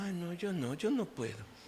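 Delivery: mock self-pity, hushed and sighing; self-pitying foot. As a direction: whimper it like a poor little victim. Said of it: "ese pie victimista".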